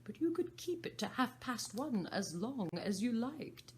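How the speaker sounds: a chattering mad hatter. Acted. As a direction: play the Hatter, excitable and high. Hatter voiced in story.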